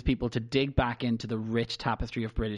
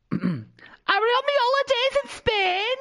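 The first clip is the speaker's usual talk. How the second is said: put-on high, slow [name] accent; loud squeaky [name] accent; [name] impression; 5 dB louder, slower than usual. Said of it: "I was gonna ask you to do an accent".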